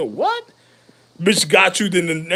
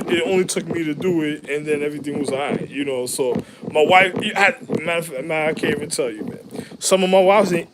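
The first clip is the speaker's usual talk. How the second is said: normal talking voice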